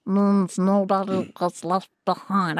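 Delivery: slow, nasal character voice; stuck in the nose. Shown as a demonstration. Coach practising a character line, slow and nasal. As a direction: push it slowly up into the nose. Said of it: "I get really stuck in my nose".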